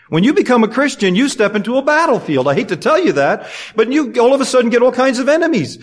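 fired-up pulpit delivery; full-force preaching. Acted. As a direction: preach it out to the congregation. Preaching about enemies.

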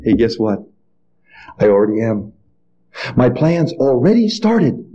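low, understated voice of God; lightly voiced God. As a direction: answer low and matter-of-fact, lightly in character. God quietly answers the prophet.

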